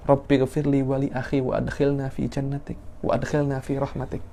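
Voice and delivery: hushed prayer for forgiveness; soft, imploring supplication; pleading recitation. Shown as a demonstration. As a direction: recite softly, begging for mercy. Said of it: "Nabi Musa meminta ampun".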